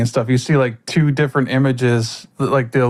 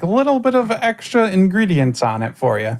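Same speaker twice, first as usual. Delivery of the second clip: sly voice; 7 semitones higher than usual. Acted. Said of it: "she smirks and she's like".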